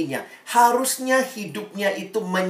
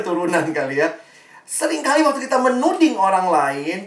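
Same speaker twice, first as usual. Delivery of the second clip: ordinary delivery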